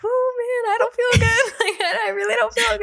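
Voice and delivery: very high, hammed-up whine of feeling ill; high comic wail of discomfort; mock suffering. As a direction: play up your misery in a high voice, laughing. Retells suffering, laughing at herself.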